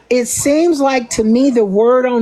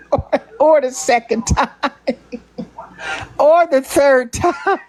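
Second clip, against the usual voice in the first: laughing; 3 semitones higher than usual. Real, not acted. Laughing through list of retakes.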